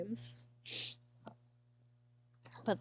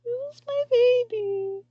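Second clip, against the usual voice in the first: high, loud crying break; sobbing wail; 9 dB louder than usual. Real, not acted. Owner mourning her lost cat, real sobbing. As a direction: let the grief burst out on every word. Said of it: "Sorry, I'm crying".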